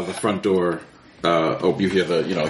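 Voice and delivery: normal talking voice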